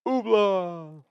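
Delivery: high, drawn-out silly squeal; playful falsetto. Pretend. Co-host playfully echoing "hoopla", high falsetto.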